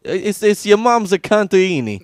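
hammed-up Italian-American trash talk; sling it like a loud Italian goombah. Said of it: "we're getting Italian on this one".

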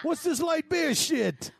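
high, slow gripe about beer; grumpy husband. Pretend. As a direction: complain like a cranky husband. Mock husband complaining.

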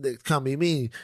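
ordinary talk, nothing marked

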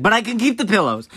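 put-on high, eager kid plea; mock-childlike bargaining; hopeful bargaining. Child bargaining after punishment.

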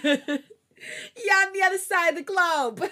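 high, loud, slow hammed-up wail; over-dramatic jeer, stretched out and laughing; mock taunt. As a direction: yell it across the world, breaking into laughter. Mock heckling of the boyfriend.

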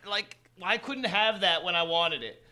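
fast whiny complaining. Retold or imitated. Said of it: "So I was like".

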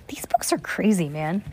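unvoiced hushed aside; whispering. Real, not acted. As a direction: whisper so the sleeper stays asleep. Child asleep beside reader.

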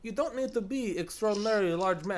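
high, loud mock Russian accent; hammed-up, insistent Russian accent. Soviet guy explaining how to fly it, mock Russian accent. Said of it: "your horrible Russian accent".

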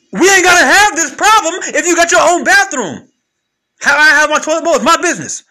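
loud, high shouting at a partner; mock argument. Man yelling at his partner over the toilet seat, loud and high. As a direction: yell it like a couple fighting.

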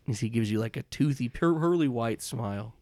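usual speaking voice

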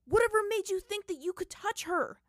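high, loud raging demand. Acted. Aang rages at Zuko, ignoring Katara, loud and high. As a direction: rage at him. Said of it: "Aang raged".

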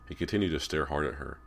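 usual speaking voice

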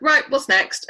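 blunt. Retold or imitated. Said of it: "really blunt".

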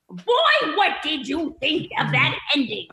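high squawking comedian, laughing through it; strained high squawk; Gilbert Gottfried voice. Acted. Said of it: "get into my Gilbert Gottfried".

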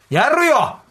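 shouted back in protest; loud insistence. Real, not acted. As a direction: insist loudly he'd do it. Insisting he would fight.

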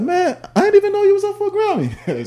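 mock a hater playing dumb; exaggerated fake surprise; fake-surprised hater. Mocking haters pretending ignorance.

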